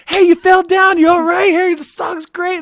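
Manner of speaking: high, loud, friendly fan voice; a concertgoer checking on someone who fell; cheery stranger voice. Retold or imitated. Fan helping the fallen queen, high cheery call.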